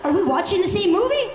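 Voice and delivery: high, fast disbelief; baffled outburst at the mom; incredulous. Real, not acted. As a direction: ask it in total disbelief.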